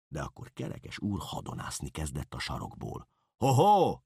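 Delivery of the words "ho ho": high, loud call to stop; shout. A narrator's reading. Kerekes úr waves from the corner, shouting to interrupt. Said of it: "kiáltotta".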